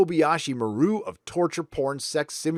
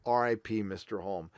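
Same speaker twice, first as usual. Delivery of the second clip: hushed farewell; 5 semitones lower, 9 dB quieter than usual. Real, not acted. Repeats tribute, much softer.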